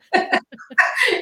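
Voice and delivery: high bright burst of laughter; real laugh after teasing her niece. Real, not acted. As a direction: laugh at your own joke.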